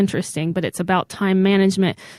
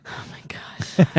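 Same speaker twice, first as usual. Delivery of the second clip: whispering; 7 dB quieter, slower than usual. Real, not acted. Unvoiced slow whisper.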